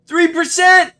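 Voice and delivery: high, loud thrilled yell; excited shout. Real, not acted. Streamer shouting at viewers over a lucky find. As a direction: shout it out in delight. Finds rich ore.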